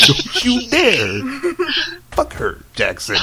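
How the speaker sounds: loud, drawn-out mock threat; hammed-up furious warning. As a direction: blast the opening slowly, then drop away. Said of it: "you're getting kinda real right now".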